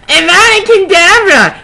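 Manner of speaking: high loud long hammed-up cry of the spell; mock epic spell yell. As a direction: blast the curse out. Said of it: "he yelled at Snape and Lupin".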